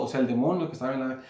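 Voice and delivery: ordinary delivery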